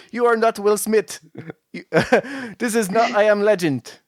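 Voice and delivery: high, hammed-up Italian accent; scolding citizens in a jokey Italian accent; mock Italian accent. Mayor scolding people for going out, in a hammed-up Italian accent. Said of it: "This is my Italian accent".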